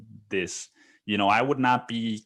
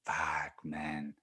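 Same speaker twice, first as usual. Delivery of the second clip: deflated mutter; 5 semitones lower, 10 dB quieter than usual. Retold or imitated. Boss after hanging up.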